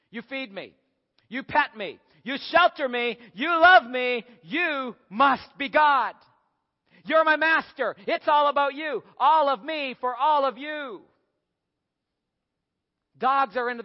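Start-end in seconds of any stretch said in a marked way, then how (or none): 0.1-11.0 worship your master, building higher and louder; devoted dog, rising to loud high awe; adoring dog. Acted. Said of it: "The dog says".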